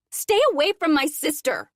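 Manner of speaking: loud, high furious call; angry shout. Acted. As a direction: yell after them fiercely. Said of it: "Kendall calls after them".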